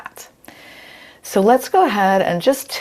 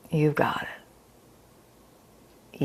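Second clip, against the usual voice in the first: soft whisper; 2 semitones lower, 9 dB quieter than usual. Real, not acted. Encouraging students softly.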